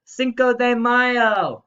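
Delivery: high, slow festive shout; slow cheering Cinco de Mayo; excited cheer. Real, not acted. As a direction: announce it like a party. Birthday greeting opener.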